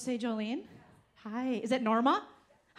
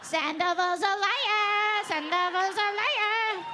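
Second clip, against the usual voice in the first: put-on drunk fan; 7 semitones higher, slower than usual. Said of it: "She's like".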